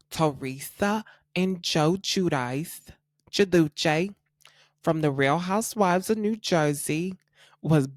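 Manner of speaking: put-on New Jersey accent. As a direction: play up a New Jersey accent on the names, just lightly. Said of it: "like, a New Jersey accent".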